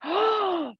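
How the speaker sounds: sharp, high re-enacted gasp; mock shocked intake, high-pitched "oh"; startled gasp. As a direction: gasp as if caught off guard. Startled as curtain whips open.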